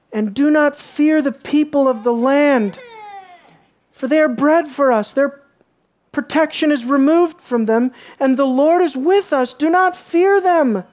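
loud, high proclaiming read; fervent sermon reading; preaching scripture aloud. Acted. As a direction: declaim the verses to the whole room. Reads scripture aloud, full force.

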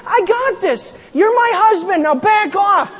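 a wife shouting down her husband's rival; defiant retort. Acted. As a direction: stake her claim and push him back. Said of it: "she could have stood up and said".

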